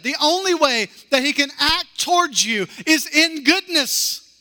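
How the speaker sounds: high, slow, emphatic sermon voice; impassioned preaching. Acted. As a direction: preach it out, each word weighed. Emphatic preaching on God's goodness.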